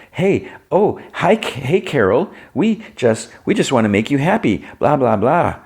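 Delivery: mock her fake perkiness; mock smiley chirp. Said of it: "All smiley".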